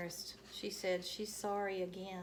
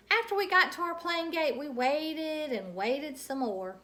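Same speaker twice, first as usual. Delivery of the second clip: bright, sing-song read-aloud voice; lively story narration; 7 semitones higher, 7 dB louder than usual. A narrator's reading. Teacher reading a Junie B chapter aloud, animated. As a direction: read it like a children's book.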